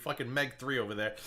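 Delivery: ordinary delivery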